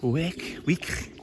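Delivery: high-pitched mock Scouse; Liverpudlian accent. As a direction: exaggerate a thick Liverpool accent. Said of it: "really strong, thick Liverpudlian accent".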